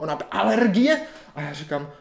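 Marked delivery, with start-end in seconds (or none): 0.3-1.0 high, loud one-word demand; imitated yelling doctor. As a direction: shout the question at the patient. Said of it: "po mně křičela". (napodobený hlasitý dotaz křičící doktorky)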